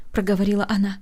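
plain reading voice

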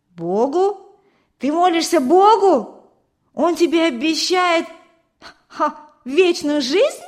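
high, loud incredulous questions; scornful disbelief. A narrator's reading. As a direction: scoff in astonishment, building from the first word. Boy scoffs at girl's praying.

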